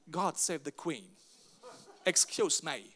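slow, lofty British English; posh British accent. Retold or imitated. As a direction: savour it like tea with the Queen. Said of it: "Das hört sich so edel an". (langsam, vornehmes britisches Englisch)

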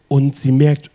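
ordinary delivery

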